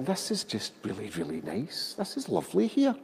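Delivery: soft, breathy, near-whispered drift, lifting high at the end; hushed blissed-out thought, fading almost to a whisper; mellow stoned musing. Retold or imitated. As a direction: dreamily murmur it as if stoned and at peace. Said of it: "I'm all mellowing at one with the universe".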